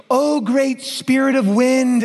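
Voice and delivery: high, slow, hammed-up solemn prayer; lofty mock invocation, drawn out. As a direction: imitate a woman praying to the wind. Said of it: "she's like".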